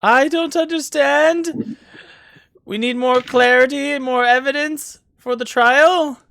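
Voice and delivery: very high, loud theatrical judge voice; agitated judge. Acted. Judge answers the defendants' plea, high-pitched and agitated. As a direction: proclaim it high and loud to a courtroom. Judge Jasper speaking in court.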